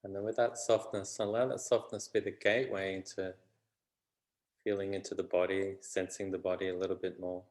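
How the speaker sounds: unhurried meditation voice with long pauses; calm, even delivery, phrase by phrase; guided meditation. Real, not acted. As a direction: gently guide them inward, leaving space between phrases.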